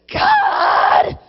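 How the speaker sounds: very high, loud, crying tantrum; tantrum shout. Acted. Believer throwing a crying tantrum at God, very high and loud. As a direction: scream at God like a sobbing child.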